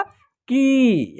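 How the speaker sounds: की held for a while on a long ee; drawn-out vowel. Shown as a demonstration. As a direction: stretch out की slowly. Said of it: "यानी कुछ वक्त तक होगा".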